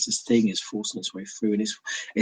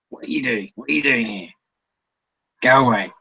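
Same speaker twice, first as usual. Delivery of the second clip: lightly voiced seagull. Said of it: "it cries out at you, going".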